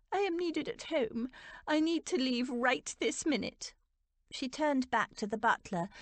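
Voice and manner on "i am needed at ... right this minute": high, urgent insistence; agitated older aunt demanding to go; upset. A narrator's reading. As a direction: insist in an upset, raised pitch. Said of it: "George had never seen her so upset".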